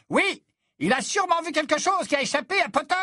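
voix plus haute, timbre changé, moqueuse; commentateur moqueur. Acted. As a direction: se moquer de Potter au micro. Smith commentates, gloating over Potter. (le commentateur Smith raille Potter au mégaphone, voix aiguë)

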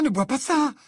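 high, loud, alarmed plea. A narrator's reading. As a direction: repeat the warning with rising alarm. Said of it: "répéta Hermione alarmée".

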